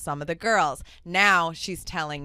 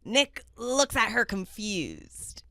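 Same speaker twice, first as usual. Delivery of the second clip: slow, higher attempt at a Scottish narrator; [name]'s Scottish accent; 5 semitones higher, slower than usual. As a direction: stretch out the stage direction in rough Scottish. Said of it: "I gotta get my Scottish accent going".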